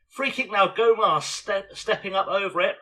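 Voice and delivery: excited commentary. Real, not acted. Excited commentary on free kick.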